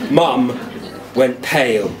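ordinary delivery

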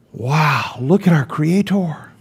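hushed, awestruck wow; awed. Retold or imitated. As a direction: marvel quietly at the Creator. Awed worshipper looks up at God.

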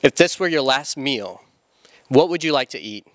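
usual speaking voice

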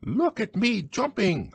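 very high little Roo squeal; tiny, high-pitched child voice; squeaky. A narrator's reading. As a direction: squeak it like an excited baby animal. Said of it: "squeaked Roo".

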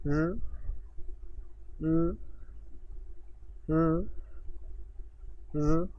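र said with the tongue vibrating only lightly; a soft, barely rolled र; Chinese r sound. Shown as a demonstration. Teacher showing students a sound Chinese learners find hard, lightly rolled. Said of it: "repeat करें मेरे साथ तीन मरतबा".

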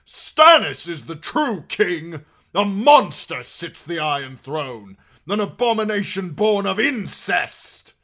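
high, full-force shouted accusation; defiant shout. A narrator's reading. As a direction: shout it out to the whole hall. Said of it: "shouted out".

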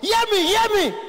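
very high, loud phone shouting; poor man. Retold or imitated. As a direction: yell shrilly down a bad line. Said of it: "voice is always very high".

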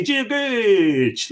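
bright greeting. Real, not acted. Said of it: "And I say".

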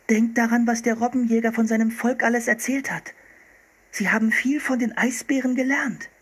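lightly voiced eager girl; insistent young girl. A narrator's reading. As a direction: persuade her brother like a determined little girl. Anne argues with her brother.

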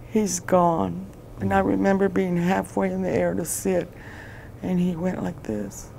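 real grief, faltering into fragments; tearful. Real, not acted. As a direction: voice rising high, close to breaking. Recounts learning of son's death.

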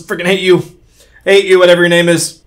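loud furious yelling; angry shout. Real, not acted. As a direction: shout at the player who killed you. Yelling at the killer.

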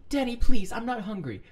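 high-pitched protest; pleading. Acted. Refusing food from Danny, high pleading. Character pleads not to eat.

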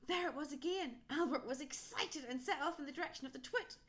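plain reading voice

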